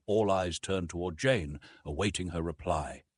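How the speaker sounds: plain reading voice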